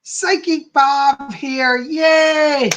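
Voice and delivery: high, loud, drawn-out hello to viewers; excited greeting. Real, not acted. Host greeting live stream viewers, bright and drawn out. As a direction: call out a big slow welcome.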